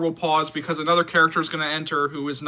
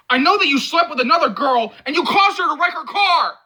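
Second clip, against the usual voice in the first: furious accusation; 11 semitones higher, 7 dB louder than usual. Acted. Friend furiously accusing him.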